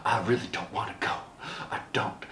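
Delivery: soft, fast, fading to a whisper; lightly voiced reluctant woman; hushed reluctance. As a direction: murmur it like you barely want to say it. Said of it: "[name]'s reluctant reply".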